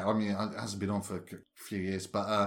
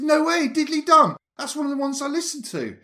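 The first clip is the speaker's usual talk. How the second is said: much higher, louder, amazed fan voice; thrilled fan; 7 dB louder than usual. Retold or imitated. Fan meeting the host's sister, higher and amazed. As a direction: gush like a starstruck listener.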